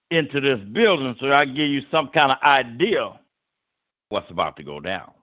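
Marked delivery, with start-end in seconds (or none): none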